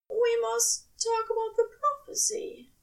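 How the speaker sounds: the angel reluctantly raising bad news; high angel voice, mildly regretful. A narrator's reading. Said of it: "sounding regretful".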